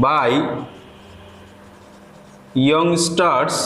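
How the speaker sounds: slow, word-by-word dictation with long pauses. Acted. Teacher dictating a paragraph to students, slow and deliberate. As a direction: read each word out clearly so students can write it down.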